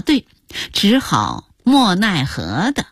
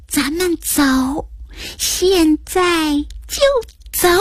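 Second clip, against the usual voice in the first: high, slow, word by word; playful coaxing; 9 semitones higher, slower than usual. Acted. Grandpa invites granddaughter out now, playful slow coaxing. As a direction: draw it out like a shared secret. Grandpa agrees to go for ice cream.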